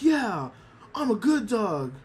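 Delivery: proud dog. Retold or imitated. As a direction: say it like a pleased pup. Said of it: "He's just like".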